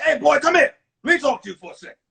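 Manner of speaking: loud, imitated summons from the lunch guy; calling a boy over. Lunch guy calls a heartbroken boy over, loud summons. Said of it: "He came, he said".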